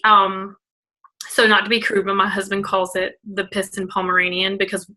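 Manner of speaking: ordinary talk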